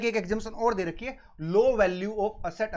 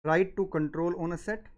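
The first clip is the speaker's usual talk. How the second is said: dictating. Shown as a demonstration. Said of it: "अगर आप लिखना चाहो तो सर यहाँ पे लिख लो".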